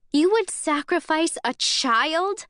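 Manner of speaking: disbelieving fury, voice trembling; horrified outrage at a child sacrificed; shaking with outrage. Acted. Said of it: "My voice is shaking now".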